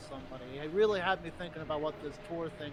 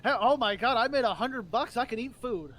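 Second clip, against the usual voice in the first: higher and louder, overjoyed at a hundred bucks; mock excitement; 7 semitones higher, 9 dB louder than usual. Mimicking a worker's payday glee, higher and louder. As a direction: ham up the thrill of a small payday. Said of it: "That, that's how I".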